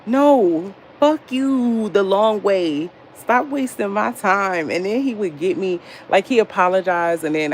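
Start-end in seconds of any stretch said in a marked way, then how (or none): 0.1-4.7 drawn-out, higher-pitched indignant cussing; retold angry retort. Friend refusing his excuses, acting out a slow, angry retort. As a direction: stretch each word in acted exasperation.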